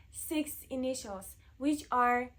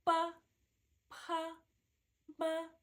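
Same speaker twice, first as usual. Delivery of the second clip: normal talking voice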